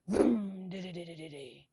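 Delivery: imitated revving engine fading to rumble; engine vroom. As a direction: rev it then let it putter away.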